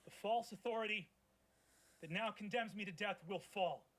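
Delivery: defiant speech. Acted. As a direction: declaim your last defiance. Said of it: "He looks out at you and goes, speaking to the crowd".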